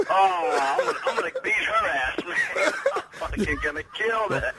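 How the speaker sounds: high, squeaky laughing mock threat; laughing relief. Real, not acted. Pranked husband learning it was a joke, laughing exasperation. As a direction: laugh helplessly through the empty threat.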